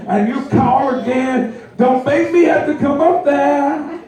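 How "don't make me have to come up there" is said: exasperated parent. Retold or imitated. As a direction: threaten to come upstairs. Parent threatens sleeping kid.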